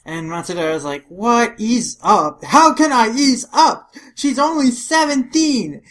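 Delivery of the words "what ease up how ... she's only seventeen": loud, high indignant protest; outraged father. Acted. Matsudaira protesting about his teenage daughter, outraged. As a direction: act out an overprotective dad's outburst.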